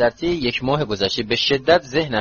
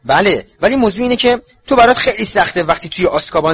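higher, louder, wounded retort; upset, lips trembling; 7 semitones higher, 5 dB louder than usual. A narrator's reading. Mother hits back at the boy's godfather, upset and raised. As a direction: argue back while visibly upset.

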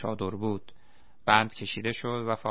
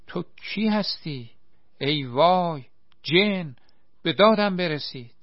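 much higher, loud panicked shrieks; terrified scream; 7 semitones higher, 5 dB louder than usual. Acted. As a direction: scream for help at the sight of a demon. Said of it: "چنان جیغی کشید".

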